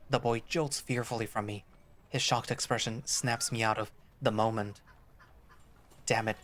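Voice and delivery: usual speaking voice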